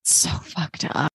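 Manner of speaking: slow breathless whisper of disgust. Real, not acted. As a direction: breathe it out, appalled. Reacting to betrayal.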